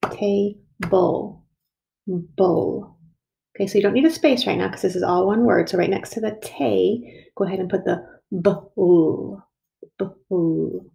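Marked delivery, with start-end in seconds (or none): 0.1-3.0 "Table" split into "tay", "bull", then "Bull" alone; clapped-out syllables. Shown as a demonstration. Teacher isolating the second syllable for pupils, split apart. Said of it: "clap out again and listen for the second syllable". 8.3-10.9 "bull" stretched, the B apart from the rest; sounded-out syllable. Shown as a demonstration. Teacher helping pupils spell the second syllable, sounded out. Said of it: "listen for the second syllable".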